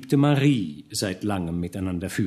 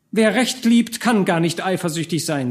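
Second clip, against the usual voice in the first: higher, louder sharp retort; displeased; 8 semitones higher, 4 dB louder than usual. A narrator's reading. Marie rebukes Georg, annoyed. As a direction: scold him for his jealousy. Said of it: "sagte Marie unmutig".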